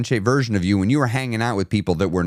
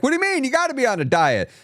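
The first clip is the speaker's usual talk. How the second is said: mock friend; 12 semitones higher than usual.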